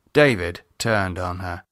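plain reading voice

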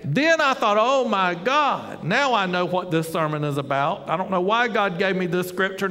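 normal talking voice

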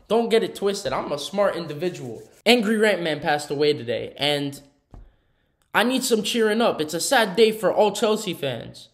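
usual speaking voice

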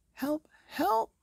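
high, loud panicked page; frantic cry for help. Acted. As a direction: shout it in alarm like a frightened page. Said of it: "cried the page".

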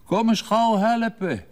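high, loud call for help from the next room. Acted. Father calls his daughter back for help, loud high call. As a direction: shout to the girl, high and loud, to come quickly. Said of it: "die riep".

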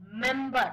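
"member" said loud with a clear m start; pronounce member. Shown as a demonstration. Teacher models member for the class, loud clear pronunciation. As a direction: sound out "member" loudly, stressing the opening m. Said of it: "Now pronounce this word".

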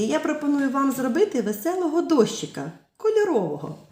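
normal talking voice

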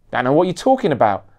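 loud, fast retort; snapped. Acted. Photographer brushing off the bodyguard, fast loud snap. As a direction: bite back defensively. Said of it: "snapped Gonzo".

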